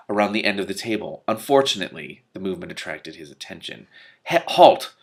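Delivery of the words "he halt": high, loud command to halt; sharp shout. Acted. Wizard orders the witch to halt, shouting. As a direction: stop her in her tracks.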